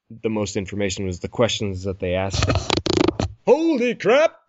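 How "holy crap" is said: real high-pitched yelp of surprise; startled outburst. Real, not acted. Host startled by co-host's mic noise, shocked exclamation. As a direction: react to a sudden racket, pitch leaping up.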